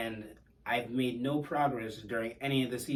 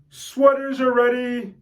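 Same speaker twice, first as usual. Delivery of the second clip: higher, louder, slow called announcement; coach's loud announcement; 12 semitones higher, 12 dB louder, slower than usual. Retold or imitated. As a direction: call it out to the whole gym. Coach announcing sweaters to team.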